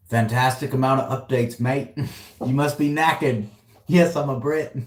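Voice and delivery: put-on playful British accent learned from a mate, Pete. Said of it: "I'm gonna try and get the accent".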